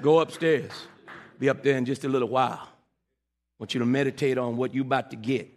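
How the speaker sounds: low, controlled parental order; stern parent. Acted. Parent punishing a child over grades, stern and low.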